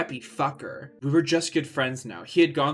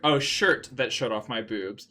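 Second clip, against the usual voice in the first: ordinary delivery, nothing marked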